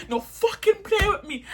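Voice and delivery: lightly put-on Jamaican accent, high and clipped; imitating Diana's Jamaican accent. Narrator imitates Diana snapping at rivals in a light Jamaican accent. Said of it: "Diana's accent was like mine".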